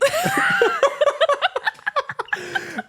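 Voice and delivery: high, loud peal of laughter; long helpless high-pitched laugh; real laugh. Real, not acted. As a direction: crack up at your own story. Laughing at own story.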